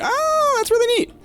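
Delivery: high, delighted "oh, that's really neat"; excited. Retold or imitated. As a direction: squeal it in pure delight. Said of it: "and I was like".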